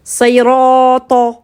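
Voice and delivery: "sayrata" drawn out slowly with heavy R and T; emphatic ta in recitation. Shown as a demonstration. Teacher reads an example from Al-Fatiha, slow heavy "sayrata". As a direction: stretch the middle of "sayrata" into a dark, thick ta. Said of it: "Vous voyez le ta, on le reconnaît".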